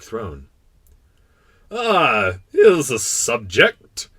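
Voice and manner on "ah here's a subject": loud, slow, higher kingly cry; exclaiming king. Acted. King catching sight of the little prince, exclaiming. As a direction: exclaim it like a delighted monarch. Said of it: "the king exclaimed".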